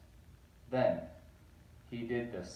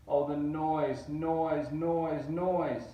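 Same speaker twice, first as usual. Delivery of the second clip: rising louder and higher on each noise; building noise cries; 5 semitones higher, 6 dB louder, much slower than usual. A narrator's reading. Narrator building the Grinch's hated noise, louder.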